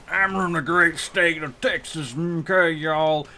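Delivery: put-on high, loud parody Texan accent; hysterical Southern accent. Mocking fake Southerners, hysterical Texan accent. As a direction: exaggerate a hysterical Southern drawl. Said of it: "in hysterics".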